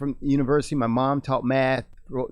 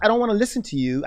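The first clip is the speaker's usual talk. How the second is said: quick, higher, dismissive brush-off; 7 semitones higher, faster than usual. Retold or imitated. Voicing someone online who won't listen, brushing it off. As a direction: toss it off like you couldn't care less.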